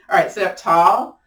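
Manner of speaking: ordinary delivery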